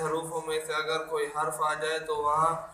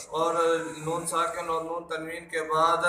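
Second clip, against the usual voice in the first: ordinary talk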